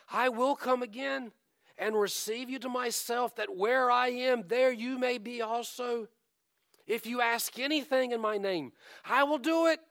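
high, loud, sustained declamation; fervent preaching. Acted. Preacher reciting Jesus' promise to return, fervently proclaimed. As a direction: build to a ringing, high-pitched promise.

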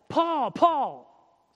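projected summons in a quoted voice; calling a name. Acted. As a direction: call out the name twice, loud and high. Voicing the Lord calling Paul.

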